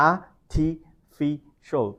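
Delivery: "Artificial" very slowly, broken as ar, ti, fi, shul; syllable-by-syllable pronunciation. Shown as a demonstration. Teacher sounding out the exact form, syllable by syllable. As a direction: pause between each syllable of "Artificial". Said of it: "the exact pronunciation is".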